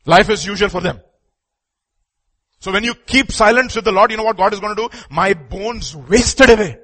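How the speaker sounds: high, loud pulpit warning; forceful preaching. Real, not acted. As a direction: drive the warning home to the congregation.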